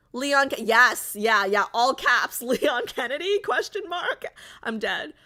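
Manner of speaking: normal talking voice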